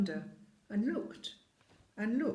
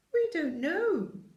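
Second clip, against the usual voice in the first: put-on high, loud, slow child voice; children answering the giant; children's voice; 10 semitones higher, 6 dB louder, slower than usual. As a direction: pipe up like a crowd of kids.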